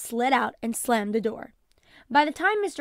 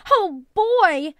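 excited cheer; 8 semitones higher, 12 dB louder than usual. Acted. Lisa learns Barney comes home.

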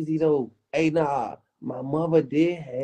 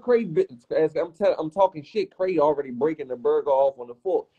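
ordinary delivery

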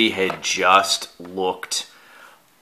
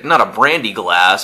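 usual speaking voice

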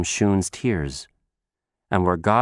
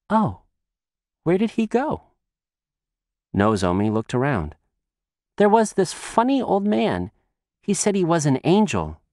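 put-on high, fast child chatter; girl running to the supermarket, high and quick; little girl voice; 8 semitones higher than usual. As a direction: chatter on like an eager small girl. Girl looks for the man.

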